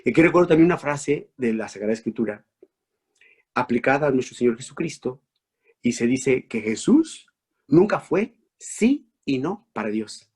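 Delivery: ordinary talk, nothing marked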